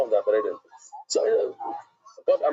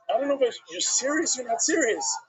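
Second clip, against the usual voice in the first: higher, teasing wife doubting him; mock wife voice; 8 semitones higher than usual. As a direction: play the wife, amused and unsure.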